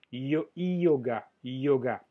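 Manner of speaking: yoga opening "ee-o", then ga; pronounced as I-O. Shown as a demonstration. Teacher breaking down the French word for students, pronounced as I-O. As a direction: glide from an i into o at the start of yoga, no sh. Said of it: "como si es que se escribía I-O".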